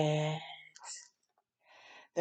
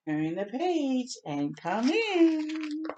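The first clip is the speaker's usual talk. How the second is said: slow invitation; 7 semitones higher, slower than usual. Acted. Clouds invite.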